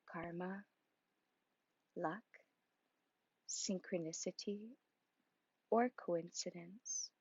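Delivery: measured, projected reading with deliberate pauses; inspirational passage reading. Acted. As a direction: read it out as a meditation's opening passage, giving each phrase room. Reads the opening passage.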